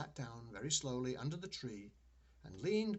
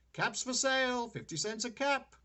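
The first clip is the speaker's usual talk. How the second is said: peddler's call; 11 semitones higher, 8 dB louder than usual. A narrator's reading. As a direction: call out your wares down the street. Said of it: "he walked back to town calling".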